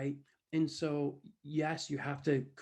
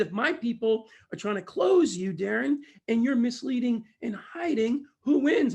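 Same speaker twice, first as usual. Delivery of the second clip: raised, emphatic imitated challenge; loud challenge; 8 semitones higher, 8 dB louder than usual. Boss challenging Darren before the audience, loud. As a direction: put the question forcefully to the room. Retold boss quizzing the room.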